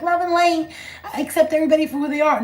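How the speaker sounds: high, sugary fake-spiritual voice; mock love-and-light persona. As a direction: parrot a phony accepting healer in high pitch. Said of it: "sick and tired of being like".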